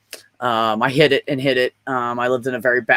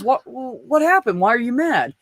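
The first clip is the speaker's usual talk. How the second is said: much higher and slow, a mother gently asking; concerned mom voice; 10 semitones higher, slower than usual. Retold or imitated. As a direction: ask slowly like a worried, caring mom. Mom voiced in childhood story.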